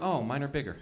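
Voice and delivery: low, soft mock disappointment; quietly deflated, played for laughs; sad inside. Boy privately let down by what he saw, quietly sad. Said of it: "I got sad inside".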